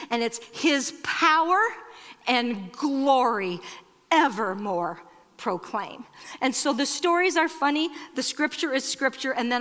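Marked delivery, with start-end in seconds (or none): 0.5-6.0 slow, stately phrases with long pauses; grand slow recitation. Shown as a demonstration. Speaker quoting the closing carol, grand slow recitation. Quotes the carol's climactic line.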